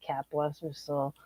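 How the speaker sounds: soft unvoiced whisper; whispered aside. Real, not acted. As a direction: breathe it like a tender aside. Aside about late mother-in-law.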